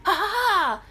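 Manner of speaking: high loud evil cackle; witch cackle. Acted. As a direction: cackle like the witch who just cursed the baby. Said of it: "And then".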